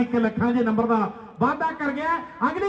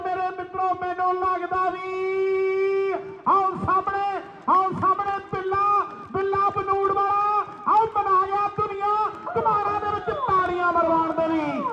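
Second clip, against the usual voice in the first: live kabaddi commentary; 7 semitones higher than usual. Real, not acted. Kabaddi raid call, drawn out.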